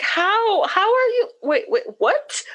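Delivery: baffled, lost for words; confused disbelief. Retold or imitated. Answering her husband's messages in high baffled disbelief. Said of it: "So I was super, like, confused".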